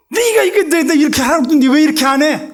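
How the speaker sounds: high, loud, fast rebuke; angry scolding. Retold or imitated. Pastor scolding the member a second time, fast loud rebuke. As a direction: fire the scolding off quickly and loudly.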